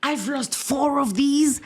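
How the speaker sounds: high, slow exclamation of wonder; retold amazement. Recalling picking up sugar bags in amazement, wowed. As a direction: marvel at it, high-pitched and drawn out. Recounting amazed reaction in shop.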